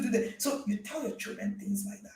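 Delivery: ordinary delivery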